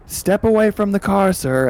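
high loud shout; cop yelling. Acted. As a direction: yell at him to step away.

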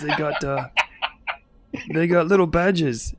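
high, loud sneering orc voice; mocking orc thug. Orc mocking the officers to his gang, sneering. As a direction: jeer at the badges like a bully. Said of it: "He's like".

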